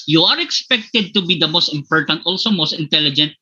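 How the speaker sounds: ordinary delivery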